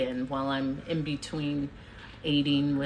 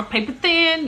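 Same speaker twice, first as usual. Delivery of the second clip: mom's voice; 12 semitones higher, 9 dB louder than usual. Retold or imitated. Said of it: "She, she'll say it".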